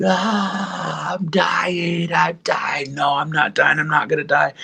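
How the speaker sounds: high, thin wail, held back in volume; mock screaming and crying. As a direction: re-enact a panicked cry without full force. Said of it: "literally screaming and crying".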